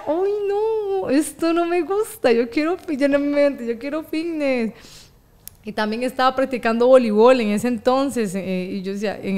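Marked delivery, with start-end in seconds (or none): 0.1-4.7 higher, whining, wrinkled-nose protest; whiny teen complaint. Retold or imitated. Girl at the smelly boxing gym, whining higher to herself.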